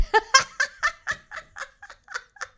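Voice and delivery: high squealing laugh. Real, not acted. Cracking up at a viewer's comment, high laughing.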